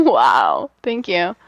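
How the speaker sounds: ordinary delivery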